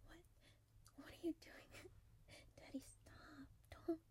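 hushed whisper. Acted. Woman hushing her partner mid-call, giggling whisper. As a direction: hiss it so the phone won't hear.

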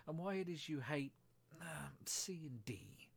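plain reading voice

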